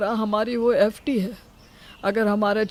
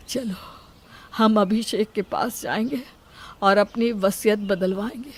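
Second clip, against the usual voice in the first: usual speaking voice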